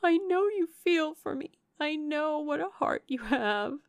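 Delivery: high, fervent, grief-laden voice; impassioned distress. A narrator's reading. Remorseful sister insisting Edward loves Eleanor, impassioned distress. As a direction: plead fervently, rising to a long high wail.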